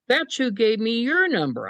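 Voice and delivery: high, casual reveal; matter-of-fact caller. Retold or imitated. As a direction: tell him plainly, as if it were nothing. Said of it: "She goes".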